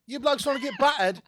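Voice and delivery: high, loud hammed-up British accent; mock British accent. Teasing British bandmates, in loud mock British accent. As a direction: throw on a fake British accent. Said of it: "I kept saying".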